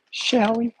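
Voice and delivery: put-on high, lilting Southern accent; Southern woman. Playfully asking as she fills the blender, in a Southern accent. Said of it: "I became a woman from the South".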